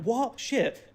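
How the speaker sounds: dumbstruck. Retold or imitated. Officials hearing his explanation, mock dumbstruck. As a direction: imitate men caught completely off guard. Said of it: "and they're dumbstruck".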